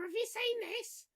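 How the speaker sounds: high, hammy Cockney voice; Cockney accent. Acted. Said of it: "entirely in terrible Cockney accents".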